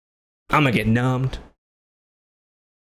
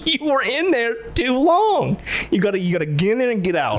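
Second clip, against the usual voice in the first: scolding the dentist; 9 semitones higher than usual. Retold or imitated. Telling the dentist off for staying too long, high complaint. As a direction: tell her off, half laughing. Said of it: "I said".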